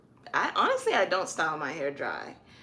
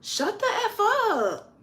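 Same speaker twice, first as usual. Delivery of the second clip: high, slow, drawn-out joking shut the F up; playful cursing; 4 dB louder, slower than usual. Shown as a demonstration. Showing the joking shut the F up, high and drawn out. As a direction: stretch it like teasing a friend. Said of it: "talking to somebody's like".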